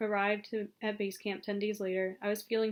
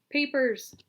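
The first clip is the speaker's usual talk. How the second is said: loud, higher-pitched official order; barked demand; 5 semitones higher, 7 dB louder than usual. A narrator's reading. Frowning Chinese officer stopping travellers at jeep, barked order.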